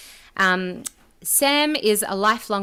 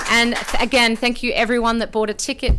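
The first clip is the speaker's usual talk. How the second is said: normal talking voice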